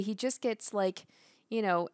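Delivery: ordinary talk, nothing marked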